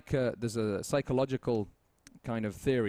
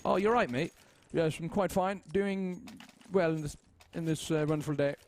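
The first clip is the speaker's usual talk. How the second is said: prim, proper English gent, higher; overly formal English; 5 semitones higher than usual. Retold or imitated. Showing how he sounds talking to someone he dislikes, overly formal English. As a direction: put on a stiff polite English voice. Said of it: "if I start speaking like this".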